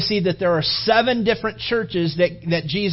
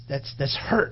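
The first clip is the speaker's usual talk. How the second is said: hushed; 4 semitones lower, 5 dB quieter than usual. Real, not acted. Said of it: "emotional whisper".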